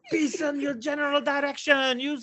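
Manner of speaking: high put-on voice, laughing through it; mock Monty Python insult. Storyteller voicing the driver's imagined insults, laughing put-on voice. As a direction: ham up a rude foreign insult. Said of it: "The bus driver is probably".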